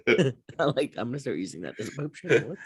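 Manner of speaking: laughing while repeating the joke; amused laugh in the voice. Real, not acted. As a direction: giggle through the line.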